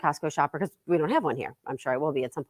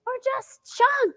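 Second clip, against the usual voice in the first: much higher, slow stretched words; mom's shriek; much slower than usual. Retold or imitated. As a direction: echo mom's screaming question. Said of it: "exactly how she said it".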